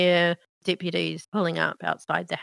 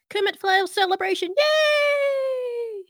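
excited cheer; slower than usual. Real, not acted. Celebrating podcast download milestone.

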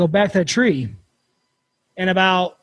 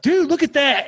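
high-pitched, urgent call to a friend; retold urgent alert; 8 semitones higher than usual. Boy alerts his friends to eyes behind the tree, urgent. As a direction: get your buddies to look, at normal volume.

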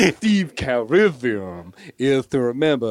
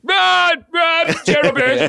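General Beers voice; 13 semitones higher, slower than usual. Shown as a demonstration. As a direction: shriek it high and urgent. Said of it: "It's always like".

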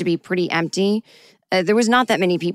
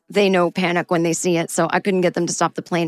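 usual speaking voice

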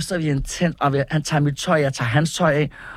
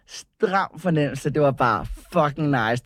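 ordinary talk

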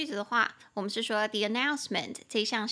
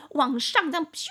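whooshing sound effect; 5 semitones higher, 6 dB louder than usual. Shown as a demonstration. Teacher picturing a chart point soaring, showy whoosh. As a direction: shoot 咻 upward like a rocket. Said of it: "我撞声词好华丽哦".

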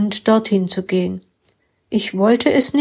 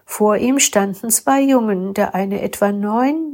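plain reading voice

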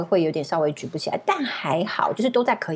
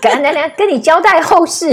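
stammering, much higher and louder; shocked disbelief, stumbling over words; surprised; 10 semitones higher, 8 dB louder than usual. Real, not acted. Shocked friend repeats news.

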